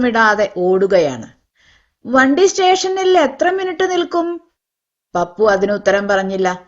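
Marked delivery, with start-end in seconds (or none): 2.1-4.4 higher, anxious passenger asking; voiced impatience from the rickshaw seat; impatient question. A narrator's reading. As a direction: press him, worried about the train. Impatient passenger presses again.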